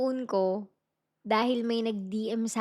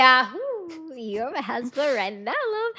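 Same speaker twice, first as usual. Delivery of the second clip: playful cheer to husband; slower than usual. Real, not acted. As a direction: cheer then tease your husband fondly. Teasing husband who asked repeat. (masayang biro sa asawa)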